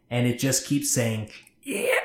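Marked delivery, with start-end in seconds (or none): 1.6-2.0 very high unnatural creature voice; like a pet taught to talk; halting, weirdly toned. Acted. The thing outside the door, halting weird voice. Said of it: "in this halting, weirdly toned voice".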